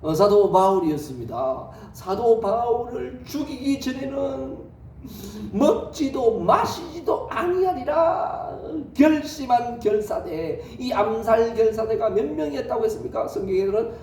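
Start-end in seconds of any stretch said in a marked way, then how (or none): 5.5-8.5 slow solemn vow. Acted. Quoting assassins' vow.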